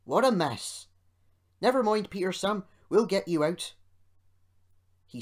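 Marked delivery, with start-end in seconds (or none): 0.0-3.7 loud, higher exclaiming voice; exclaim, then rally a friend heartily; hearty, raised rescuer's voice; Rusty. A narrator's reading. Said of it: "exclaimed Rusty".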